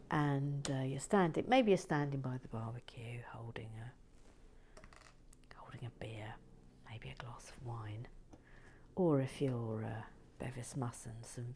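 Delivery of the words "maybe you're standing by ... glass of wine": soft whispered aside; whispering. Real, not acted. Whispering about where to place the piece. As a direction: keep it hushed.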